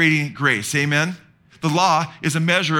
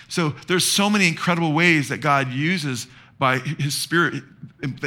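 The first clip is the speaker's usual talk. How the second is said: ordinary delivery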